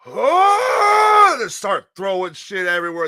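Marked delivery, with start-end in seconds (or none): 0.1-1.4 high, loud rallying "Oh!"; war-cry yell. Retold or imitated. Storyteller mimics kids starting a food fight, yelling. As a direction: holler like a mob breaking loose.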